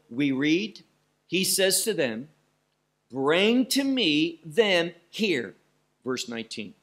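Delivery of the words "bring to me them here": slow and weighty, stress on "to"; deliberate commanding reading; emphasized. Shown as a demonstration. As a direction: stretch each word of "Bring to me them here". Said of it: "that's what's emphasized once more".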